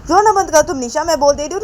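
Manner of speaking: loud, high-pitched telling-off; angry refusal. A narrator's reading. Manisha refusing Nisha the baby, angry scolding. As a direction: refuse point-blank, acting fed up.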